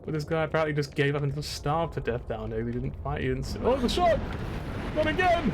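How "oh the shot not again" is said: high loud yell of alarm; real startled shout. Real, not acted. Shot by an enemy, startled loud yell.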